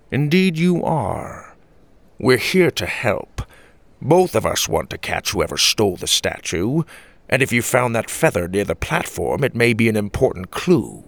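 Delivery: put-on measured old grandpa offering help; earnest grandpa. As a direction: play a grandpa, drawing out the opening words. Voices Grandpa reassuring the woman.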